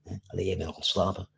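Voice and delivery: ordinary talk, nothing marked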